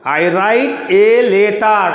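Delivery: "I write a letter" very slow with the breath stopped on each hard t; strained stop-start "I write a letter"; breath-held local English. Shown as a demonstration. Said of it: "আমাদের দমটাকে আটকে একটু কষ্ট হচ্ছে বলতে".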